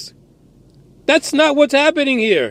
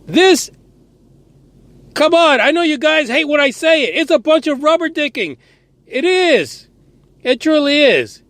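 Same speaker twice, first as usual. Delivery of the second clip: worked up; 6 semitones higher than usual. Real, not acted. Ranting about rubber dicking.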